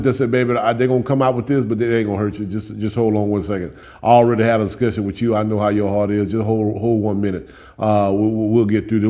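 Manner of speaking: put-on soft, low, gentle voice calming a child; tender reassuring God. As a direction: hush the worried one kindly.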